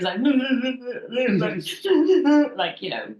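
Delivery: high, loud imitated blubbering; mock sobbing. Sobbing down the phone to the boss, exaggerated mock wail. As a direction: imitate helpless sobs, half laughing. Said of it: "just someone pure sobbing down the phone".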